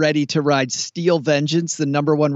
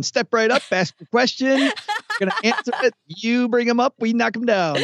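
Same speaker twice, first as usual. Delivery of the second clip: carnival barker; 8 semitones higher than usual. Retold or imitated. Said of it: "all you're doing here is going".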